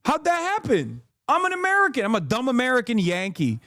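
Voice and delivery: high-pitched sarcastic bewilderment; mock cluelessness. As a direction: play the dumb Yankee, sarcastically amazed. Mock ignorance as dumb American.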